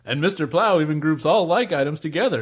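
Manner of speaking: stagey infomercial pitchman; mock product announcer; spoof ad read. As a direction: sell it like a corny commercial.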